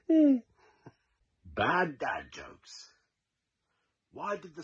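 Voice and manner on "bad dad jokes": very slow, stretched-out title; slow show title. Acted. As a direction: drag out the title like a show intro. Title announcement of each joke.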